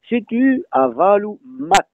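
"mat" with a short, quick A. Shown as a demonstration. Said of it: "le A est bref".

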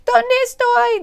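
high, loud lost cry; high scared bird voice; lost little bird. Acted. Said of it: "dijo el pajarito".

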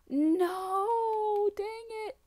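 high, loud, long cry of no; frustrated yell. Real, not acted. Player caught on an obstacle, frustrated yell. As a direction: wail it out in frustration.

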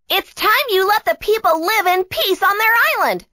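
very high character voice; plucky heroine, firm. Acted. Tia tells Brachus to leave people in peace, firm. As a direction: stand up to the bully, high-pitched.

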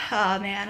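trembling sigh, close to tears; a shaky breath out, fighting tears. Real, not acted. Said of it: "I'm trying not to cry".

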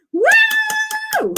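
very high whoop. Real, not acted. Streamer cheering a viewer's word count, high whoop. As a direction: whoop for joy. Celebrating chat.

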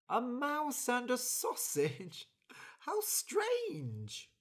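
high, slow astonished voice; surprised stranger. Acted. Stranger hears of the mouse and sausage, amazed.

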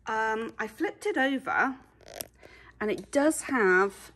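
straining under a heavy weight; carrying heavy load. Real, not acted. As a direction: talk while lifting something heavy. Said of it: "oh gosh, this is so heavy".

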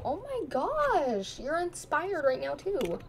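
high, slow, swooping cartoon voice; mock-concerned cartoon teasing; silly sing-song voice. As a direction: tease the angry Sim in a goofy drawn-out voice.

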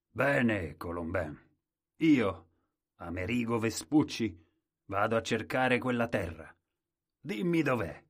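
loud, confident announcement; bold navigator. Acted. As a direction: declare himself proudly. The navigator takes up the quest.

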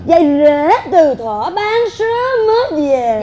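slow, drawn-out saying; pointed proverb recital. Acted. Mother lectures her in-law with old wisdom, slow and pointed. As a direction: stretch each phrase of the proverb.

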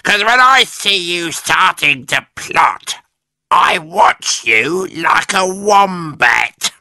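high hag voice building to a slow shout; Missus Twit. A narrator's reading. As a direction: threaten him, ending loud and drawn out. Wife warning husband.

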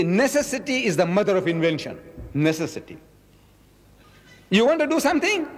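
usual speaking voice

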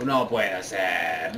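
low, slow disbelief; dismayed. Real, not acted. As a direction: drag it out in dismay. Despair at opponent's move.

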